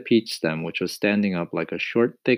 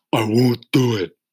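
earthworm voice; 5 semitones higher, 8 dB louder than usual. A narrator's reading. Earthworm refuses.

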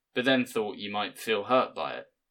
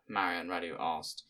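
usual speaking voice

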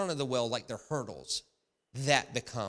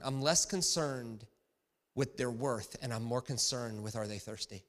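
ordinary delivery

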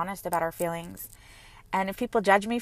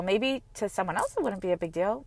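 ordinary talk

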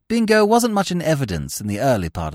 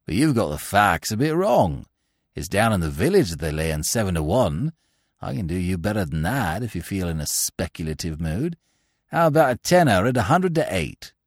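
lower, softer smug voice of a sly rival; a lower, quieter oily cad; 8 semitones lower, 4 dB quieter than usual. A narrator's reading. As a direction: drop low and purr it like a scheming bettor. Steggles voiced in narrated story.

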